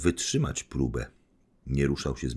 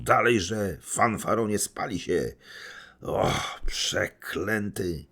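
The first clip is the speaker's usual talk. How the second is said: furious; 5 semitones higher, much slower than usual. Acted. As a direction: rage at him while grabbing his sleeve. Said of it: "z wściekłością".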